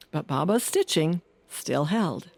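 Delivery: ordinary delivery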